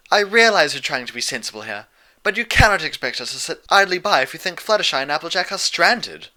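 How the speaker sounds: a genteel lady standing her ground; lightly voiced refined lady. A narrator's reading.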